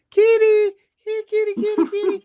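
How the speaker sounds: put-on very high, eager child voice calling the cat; little girls calling. Little girls calling out to the kitty. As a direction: call the kitty like an excited little girl. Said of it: "those girls".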